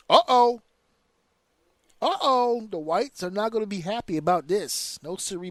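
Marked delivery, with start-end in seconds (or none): 0.1-2.7 high, drawn-out uh-oh; playful uh-oh; mock uh-oh. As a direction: sing out a teasing warning. Mock alarm at the news.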